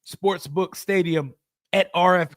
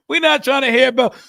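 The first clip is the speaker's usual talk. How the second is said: loud dismissal; 5 semitones higher, 7 dB louder than usual. Real, not acted. Mocking rival fans' taunts.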